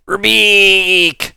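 high loud frog-monster bellow; monster roar. Acted.

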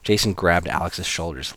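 ordinary delivery

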